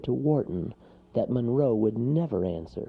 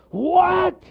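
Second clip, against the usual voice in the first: high, explosive shout; exasperated storybook bear; bear's outraged cry; 10 dB louder than usual. A narrator's reading. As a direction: bellow it in disbelief. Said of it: "cried the bear".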